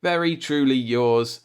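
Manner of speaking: ordinary delivery, nothing marked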